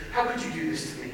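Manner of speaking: low, soft mock-wounded reproach; hushed accusation. Student in teacher's face over his F, quiet reproach.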